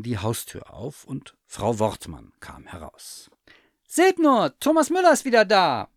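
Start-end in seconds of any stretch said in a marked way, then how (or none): 3.9-5.9 very high, loud joyful shout; excited calling. A narrator's reading. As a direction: call everyone over, high and loud. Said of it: "rief sie".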